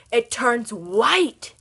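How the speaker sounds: loud, hugely drawn-out reveal; hammed-up wonder, slow and loud; mock amazement. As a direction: announce it like an astonishing discovery, stretching the last word. Mock amazement at subscribe button.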